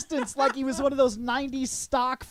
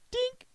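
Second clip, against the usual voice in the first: tiny high-pitched "dink" blip; high dink sound effect; 6 dB quieter than usual. Retold or imitated. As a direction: squeak a cartoon vanish noise.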